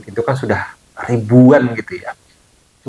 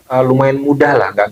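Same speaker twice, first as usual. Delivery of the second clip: ordinary talk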